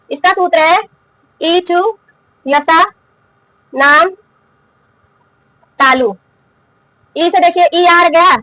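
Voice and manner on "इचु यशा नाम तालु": इचु, यशा, नाम, तालु each said alone with long pauses between; slow dictation. Shown as a demonstration. Teacher dictating the sutra for students to write, slowly. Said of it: "तो लिख लीजिए".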